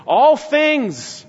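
"All" pitched high and loud; "All" hit high and hard, falling after; emphatic "All things". Shown as a demonstration. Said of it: "and it's emphatic".

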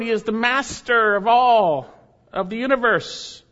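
fervent preaching; preaching with force. Acted. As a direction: proclaim it with rising fervor. Preaching climax.

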